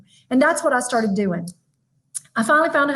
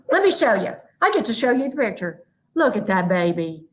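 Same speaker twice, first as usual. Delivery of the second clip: usual speaking voice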